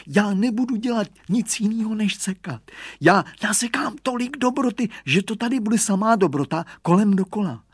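put-on high, whimpering boyish pleading; whining. A narrator's reading. Hugo swears he will be nothing but good, whining. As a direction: whine eager promises in a high voice. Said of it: "sliboval výrostek kňučivým hlasem".

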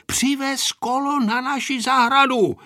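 high-pitched call to a friend; calling to someone. A narrator's reading. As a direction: call the request across to her. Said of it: "zavolal Pepíček na Anešku".

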